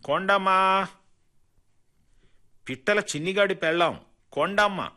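high, loud call across the yard; loud shout. A narrator's reading. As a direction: shout the woman's name out to the crowd. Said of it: "గట్టిగా అరిచాడు కిష్టయ్య". (గట్టిగా, ఎత్తైన గొంతుతో పిలవడం)

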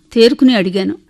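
ordinary delivery

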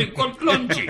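high mimic voice; caller's punchline. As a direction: deliver the punchline in a high, pleading wail. (ఎత్తైన గొంతుతో బతిమాలే పిలుపు)

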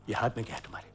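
low, soft suspicion; wary murmur. Acted. Father noticing something in son's hand, wary and hushed. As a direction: ask it under your breath.

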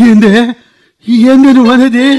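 loud, stunned cry of disbelief; shocked outburst. Acted. Husband hearing his mother tried to kill his wife, shocked outburst. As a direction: burst out in shock.

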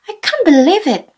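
"I can't believe it" thrown out high, loud and fast; excited. Shown as a demonstration. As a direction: burst out with excitement. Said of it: "say it with excitement".

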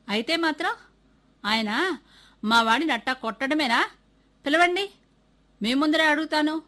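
rising into a loud, high angry demand; a furious father demanding the teacher be called; father shouting at the headmaster to call the teacher. Acted. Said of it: "అన్నాడు మళ్ళీ కోపంగా".

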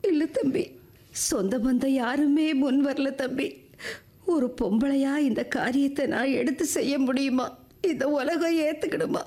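helpless plea, close to sobbing; tearful pleading. Acted. Woman with no relatives begging for kindness, tearful. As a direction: beg through tears, breaking at the end. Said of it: "நீங்க அழாதீங்கம்மா".

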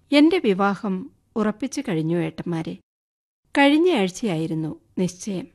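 ordinary delivery

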